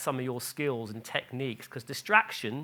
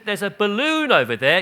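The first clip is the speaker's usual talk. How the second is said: "There's a balloon over there" said higher and louder, bright and excited, balloon rising; cheerful distraction; 6 semitones higher, 10 dB louder than usual. Retold or imitated. Mother distracting crying toddler, loud bright excited voice. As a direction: point out the balloon eagerly to a toddler. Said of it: "she said, said".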